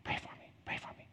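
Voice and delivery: breathy whispered plea from a sick bed; faint whisper. Retold or imitated. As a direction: whisper it, barely there, like a weak patient. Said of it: "she's whispering".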